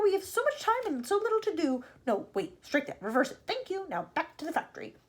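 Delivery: high, bustling excitement; excited cry. A narrator's reading. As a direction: rattle it out eagerly, correcting yourself. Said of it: "he cried, clapping his hands once".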